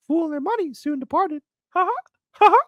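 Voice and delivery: very high squeaky cartoon mouse; Mickey Mouse voice. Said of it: "how about with Mickey".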